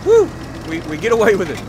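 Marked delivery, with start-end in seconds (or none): 0.0-0.3 cheer. Real, not acted. Cheer after escape.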